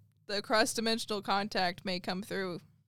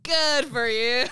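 loud, drawn-out "good for you"; big hammed-up cheer; mock congratulations; 3 semitones higher, 10 dB louder, slower than usual. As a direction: exaggerate it slowly and loudly. Exaggerated echo of co-host's remark.

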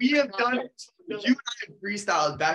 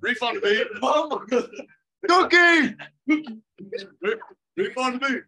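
shouted ad-libs; 5 semitones higher, 6 dB louder than usual. Pretend. Ad-libs shouted over beat.

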